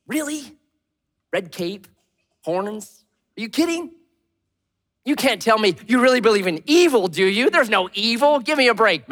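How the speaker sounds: high incredulous scoffing; mocking disbelief. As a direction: laugh off the whole idea. Skeptic mocking belief in evil.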